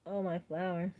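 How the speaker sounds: usual speaking voice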